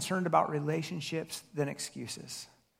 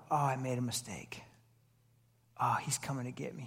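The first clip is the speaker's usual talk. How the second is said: hushed, panicked whisper of a guilty son; fearful whisper; 6 semitones lower, 9 dB quieter than usual. Retold or imitated. A son whispers in fear as his father comes running. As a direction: breathe it out in dread as Dad approaches.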